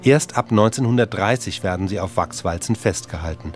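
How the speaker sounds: ordinary talk, nothing marked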